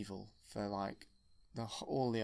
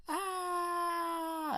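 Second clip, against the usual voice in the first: very high, loud screech; falling scream; 4 dB louder than usual. Retold or imitated. The Emperor thrown down the hole, a high, loud scream. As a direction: shriek as he falls.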